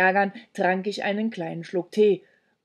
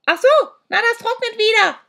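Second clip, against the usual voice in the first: put-on much higher, louder witch voice of an old woman; shrieking old hag; 10 dB louder, faster than usual. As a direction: screech at the kids like a deaf old crone. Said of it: "schrie sie zurück". (kreischende alte Hexe)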